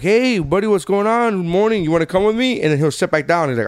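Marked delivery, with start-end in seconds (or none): 0.0-2.6 high, sing-song parent voice; greeting a baby. Retold or imitated. Dad going in to his waking baby, cheery greeting. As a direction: coax a little one, pitched up. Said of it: "I'm like".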